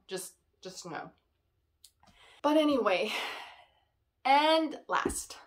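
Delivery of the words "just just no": normal talking voice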